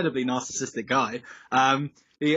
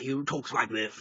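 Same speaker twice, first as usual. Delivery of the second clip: a light imitation of a plummy Oxford accent, softer; posh Oxford gent; 5 dB quieter than usual. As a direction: lightly mimic an Oxford-born gentleman. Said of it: "sort of Oxford-born gent".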